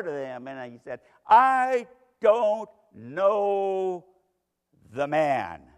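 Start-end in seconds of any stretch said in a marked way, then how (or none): 1.2-5.7 loud, very drawn-out, furious oath; vehement denial. Acted. Peter cursing and swearing to the bystanders, vehement denial. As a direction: swear it forcefully, dragging out every word. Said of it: "the vile vehemence of this man".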